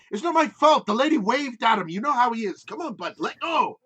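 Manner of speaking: a defensive Irish lilt, coaxing his pet; Irish accent. Acted. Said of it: "like, Irish accent goes".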